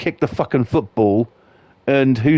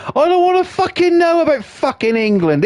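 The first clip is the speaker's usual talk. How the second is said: exasperated yell. Real, not acted. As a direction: shout it in disgust at the phone. Outburst at football notification.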